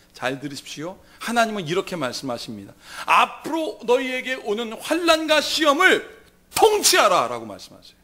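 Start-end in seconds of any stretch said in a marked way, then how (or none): 3.1-7.3 raised, slow divine command; commanding proclamation. Acted. God commanding believers to rule trials, slow proclamation. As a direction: proclaim it firmly, word by word.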